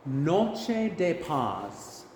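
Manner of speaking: Spanish phrases spoken slowly, syllable by syllable; slow Spanish pronunciation model. Shown as a demonstration. Choir director saying Spanish phrases to repeat, slow pronunciation model. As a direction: stretch each Spanish syllable for learners to copy. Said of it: "I'll say it first and then you can repeat after me".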